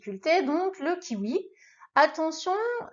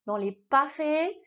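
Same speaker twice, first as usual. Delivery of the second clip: ordinary talk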